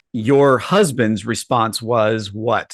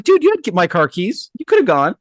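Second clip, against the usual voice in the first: defensive retort; 7 semitones higher than usual. Retold or imitated. Retorting to angry brother.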